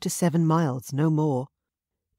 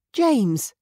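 higher, louder call of the name; 8 semitones higher, 5 dB louder than usual. A narrator's reading. Mary calling to James, higher and louder. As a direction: call the boy over. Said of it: "Mary called".

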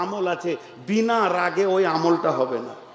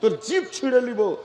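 mock angry imam, pitched higher; imam's angry rebuke; 8 semitones higher than usual. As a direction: snap at someone as an angry imam. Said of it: "ইমাম সাহেব রেগে গেছে". (রেগে যাওয়া ইমামের ধমক, উঁচু গলায়)

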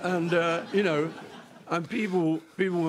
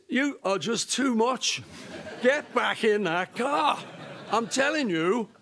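mock scolding; 8 semitones higher, slower than usual. Scolding the mugger back.

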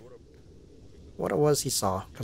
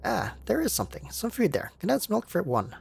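eager chef; 8 semitones higher than usual. Acted. Chef pitching food to stranger.

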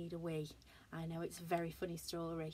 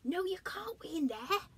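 plain reading voice

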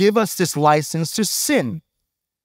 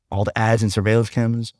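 ordinary talk

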